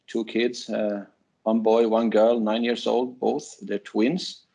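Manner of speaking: ordinary talk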